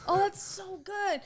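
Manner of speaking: ordinary talk, nothing marked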